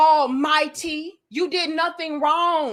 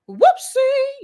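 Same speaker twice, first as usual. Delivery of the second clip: mock whoopsie; 13 semitones higher, 6 dB louder than usual. Mocking fake sympathy to critics.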